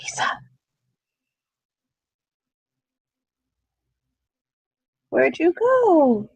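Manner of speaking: sing-song call for a missing player. Real, not acted. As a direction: call her name across the room, stretched slow. GM calls to absent player.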